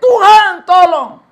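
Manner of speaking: high, loud desperate shout; man falling into a ravine screams for God's help; retold cry for help. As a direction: yell to God from the ravine. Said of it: "dia berteriak".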